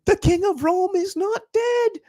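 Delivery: high-pitched, slow imitation of a North Irish commentator; North Irish accent. Fan mimicking the commentator's goal call in a North Irish accent. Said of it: "in his North Irish accent".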